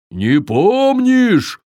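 high, slow astonished question; surprised. A narrator's reading. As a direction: sound truly amazed. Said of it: "удивился Таппи".

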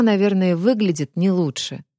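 ordinary delivery, nothing marked

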